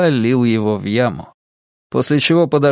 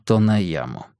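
ordinary delivery

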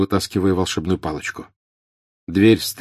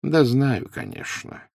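condescending; 6 semitones higher, 3 dB quieter, slower than usual. A narrator's reading. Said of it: "снисходительно произнёс Дамблдор".

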